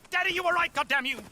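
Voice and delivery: a ruined showman cursing his father's memory; bitter outburst. Acted. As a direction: spit it at the ceiling. Cursing absent father aloud.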